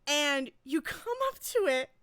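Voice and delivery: ordinary talk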